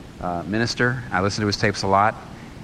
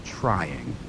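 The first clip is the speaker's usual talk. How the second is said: delicate understatement; 2 semitones lower, 3 dB quieter, much slower than usual. Retold or imitated. Preacher quoting a bachelor minister on children, delicately understated. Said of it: "how do I put this".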